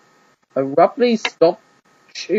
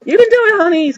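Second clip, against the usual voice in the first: much higher, louder cheer from the stands; calling out; 6 dB louder than usual. Acted. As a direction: call across the stadium to her son. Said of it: "called out from her seat".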